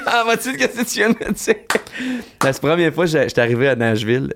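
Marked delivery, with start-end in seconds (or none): 0.1-1.5 much higher-pitched mock question; mock bafflement. Baffled listener asking someone to repeat, high mock bafflement. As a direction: act out not understanding, voice way up high. Acted baffled reply to accent.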